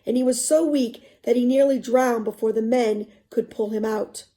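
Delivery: expressive read-aloud narration; story narration. A narrator's reading. Teacher reading a novel to students, expressive narration.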